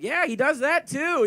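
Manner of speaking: high, loud mock-exasperated outburst; emphatic agreement. Ex-girlfriend venting to her friend, emphatic agreement. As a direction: drag it out, imitating an indignant ex.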